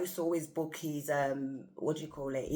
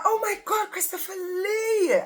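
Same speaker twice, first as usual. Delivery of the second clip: high, loud, crying-out squeal of delight; starstruck squeal; 8 dB louder than usual. Retold or imitated. Fan meeting her idol, starstruck squeal. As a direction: shriek his name like a starstruck fan.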